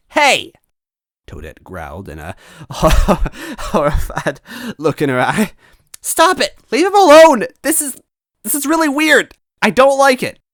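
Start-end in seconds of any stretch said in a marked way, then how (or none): 0.1-0.5 very high, loud hey; sharp shout. Acted. Toadette shouting at the tickling henchman. 2.5-5.5 narrating through giggles; a touch of leftover laughter; still laughing. Real, not acted. Narrator still giggling from the scene. 6.0-10.4 very high, loud protest; shrill shouting plea. Acted. Toadette screaming at them to leave Luigi.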